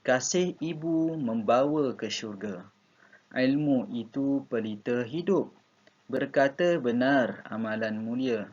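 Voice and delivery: measured classroom demo reading; model reading aloud. Shown as a demonstration. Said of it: "baca dengan intonasi yang betul".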